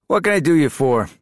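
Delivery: bright, high, loud call across the kitchen; cheery call-out. Acted. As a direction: call it out with a grin. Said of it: "called out to Jed with a smile".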